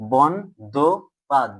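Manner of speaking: "বন, দো, পাদ" as three separate beats; syllable by syllable. Shown as a demonstration. Teacher building up the word for pupils, "বন, দো, পাদ" each apart. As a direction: sound out "বন, দো, পাদ" with short pauses. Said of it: "উচ্চারণ করো স্বল্পতম প্রয়াসে".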